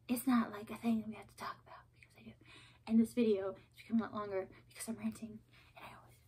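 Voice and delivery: fast whispered aside; hurried whispered confession. Real, not acted. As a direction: rush it under your breath.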